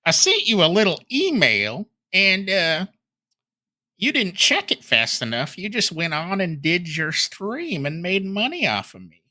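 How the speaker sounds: put-on sustained caricature accent of a petty complainer; Phil impression. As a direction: whine the grievance in a silly high accent. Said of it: "I think I've changed his accent again".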